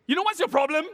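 high, loud accusing demand; confrontational. Shown as a demonstration. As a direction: demonstrate how not to confront someone. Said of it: "do not go to that person and say".

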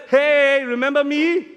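loud, high mock greeting; hammed-up gloating hello; playful taunting call. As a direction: holler it like a cheeky gotcha. Imagined taunt to Pontius Pilate.

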